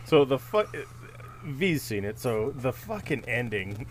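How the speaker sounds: ordinary talk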